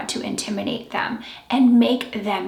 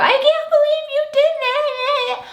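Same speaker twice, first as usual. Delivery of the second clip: high, whining complaint; whiny voice; 4 dB louder than usual. Shown as a demonstration. Coach showing the weak whining tone to avoid. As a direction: whine it like a sulky child. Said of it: "When you whine, you go".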